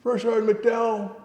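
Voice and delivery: very high, loud, incredulous question; astonished recognition. Retold or imitated. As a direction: act out a man amazed at the name. Officer astonished on recognising him.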